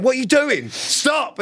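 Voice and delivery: high yelling at Sam to stop; retold panicked yell. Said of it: "I'm on the back going".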